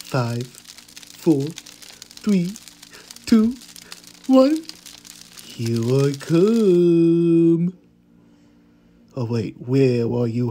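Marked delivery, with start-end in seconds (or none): 0.1-4.6 building countdown. Pretend. Countdown as killer. 5.4-7.7 mock villain. Killer starting the hunt.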